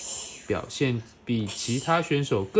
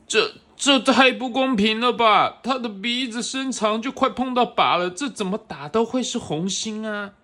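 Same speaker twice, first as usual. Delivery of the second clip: complaining rival; 8 semitones higher, 4 dB louder than usual. Acted. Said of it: "其他选手们非常不满".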